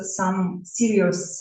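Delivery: ordinary delivery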